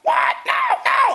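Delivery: very high, loud shocked cry; outraged shriek. Retold or imitated. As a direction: shriek in disbelief. Mom hears son blamed.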